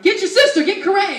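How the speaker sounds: high, loud mock mom order; theatrical yelled command; imitating mom yelling. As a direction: holler for the kids like a fed-up mother. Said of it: "the production of yelling at us".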